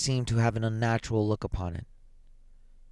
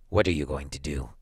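hoarse whisper; 8 semitones lower than usual. Acted. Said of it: "he whispered hoarsely".

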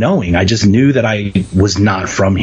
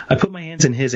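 said through a laugh, softer; giggling while retelling the moment, much softer; 6 semitones higher, 5 dB quieter than usual. Real, not acted. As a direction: let a real laugh break in, softer. Repeats line while laughing.

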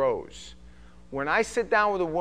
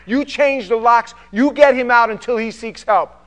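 much louder, higher, pressing warning; urgent shouting warning; 7 semitones higher, 14 dB louder than usual. Retold or imitated. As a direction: hammer it home to save her. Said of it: "And I'll go".

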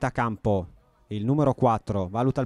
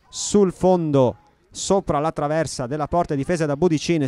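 ordinary talk

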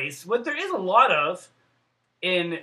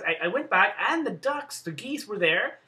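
usual speaking voice

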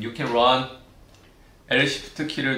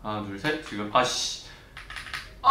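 normal talking voice

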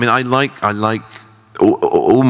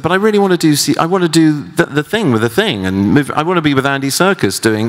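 eager pleading; 11 semitones higher than usual. Retold or imitated. Eager pleading quote of himself.

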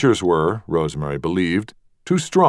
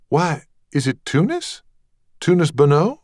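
high, rising surprise; surprised recognition; 10 semitones higher than usual. Acted. Rosemary recognising the visitor, high surprised. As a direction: lift it in pleased surprise.

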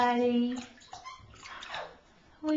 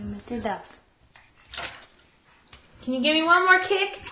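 ordinary talk

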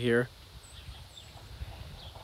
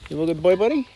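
praising the dog; 5 semitones higher, 7 dB louder than usual. Real, not acted. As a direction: cheer your dog on.

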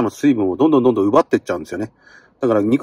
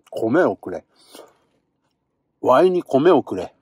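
usual speaking voice

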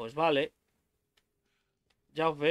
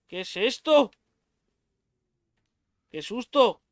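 higher, louder shaken exclamation; 6 semitones higher, 5 dB louder than usual. Real, not acted. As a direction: still rattled by the scare. After jump scare. (asustado y alterado)

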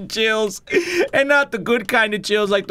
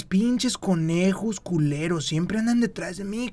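normal talking voice

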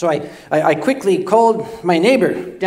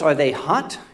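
ordinary talk

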